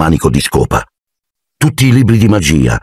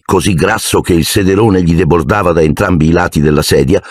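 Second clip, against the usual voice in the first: ordinary delivery, nothing marked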